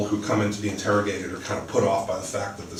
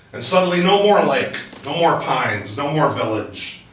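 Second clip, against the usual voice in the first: each "more" hit and falling, the first one loud and high; bitter repeated "no more" with falling tune; stress on more; 6 semitones higher, 6 dB louder than usual. Acted. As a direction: lean on "no more" three times, slowing toward "village". Howard's bitter repeated lament.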